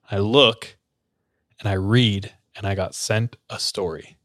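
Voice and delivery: ordinary talk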